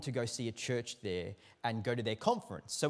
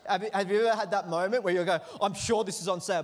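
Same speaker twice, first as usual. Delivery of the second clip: excited; 3 semitones higher, 6 dB louder, faster than usual. Real, not acted. Building excitement to congregation.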